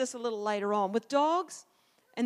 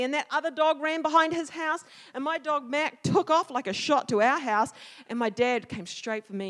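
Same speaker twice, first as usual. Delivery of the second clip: plain reading voice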